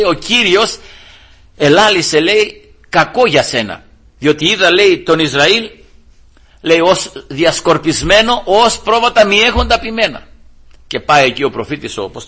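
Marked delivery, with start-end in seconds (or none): 0.0-10.3 higher, ringing prophetic delivery; preaching proclamation. Acted. Prophet gives the king the Lord's word, proclaimed in preaching.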